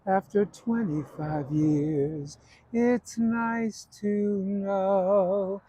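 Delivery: very slow, drawn-out sly delivery; Zero Mostel ad-lib. Retold or imitated. Tevye ad-libbing to Golde at the end of the song, very slow and sly. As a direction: stretch every word out, slyly. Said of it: "one night he went".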